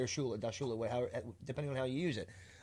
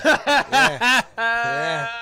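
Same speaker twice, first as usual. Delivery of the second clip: laughing yeah; 9 semitones higher, 14 dB louder than usual. Real, not acted. Laughing at his own joke.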